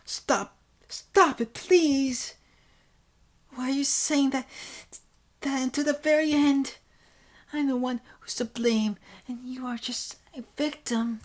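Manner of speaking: put-on high-pitched, loud pleading girl; distressed girl. Character begging someone to stop taking blame, distressed high voice. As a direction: plead in a high girlish voice, protesting.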